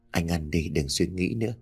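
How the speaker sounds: soft caring plea to eat; gentle urging. Acted. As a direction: soothe a worn-out husband. Wife gently urges tired husband.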